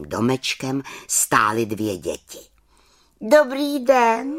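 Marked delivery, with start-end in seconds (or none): 3.2-4.3 high, loud, slow little witch greeting; calling out greeting. A narrator's reading. Little witch calls out hello to two lost children, high and loud. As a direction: call out a bright hello to the children. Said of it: "zvolala malá čarodějnice".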